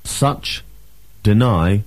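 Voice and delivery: ordinary talk, nothing marked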